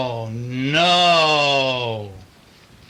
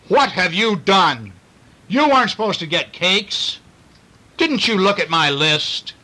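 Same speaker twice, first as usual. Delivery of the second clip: scolding mother; 4 semitones higher, 3 dB louder than usual. Acted.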